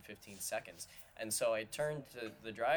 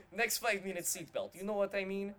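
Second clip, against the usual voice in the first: put-on higher, a light Russian accent; Russian driver impression; 8 semitones higher than usual. Quoting the Russian Uber driver to friends, light Russian accent. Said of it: "and goes".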